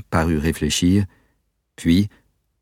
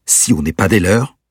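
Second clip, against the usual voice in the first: quick, louder end of a heated rant; 3 semitones higher, 5 dB louder than usual. Acted. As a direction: fire it off at the end of the tirade. Peter's angry tirade at captor.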